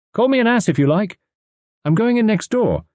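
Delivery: high-pitched, determined declaration; insist, voice pitched well up; headstrong insistence. A narrator's reading. Tuppence to husband before going next door, high and insistent.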